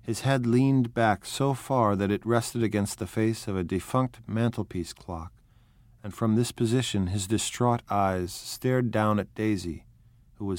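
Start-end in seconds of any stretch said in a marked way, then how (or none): none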